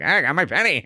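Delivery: put-on cranky old codger grumble; old prospector. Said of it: "And he's like".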